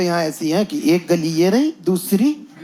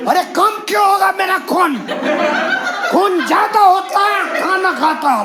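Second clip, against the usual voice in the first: much higher shout, slowing at the end; mock driver shout; 10 semitones higher than usual. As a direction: bellow it like the offended driver.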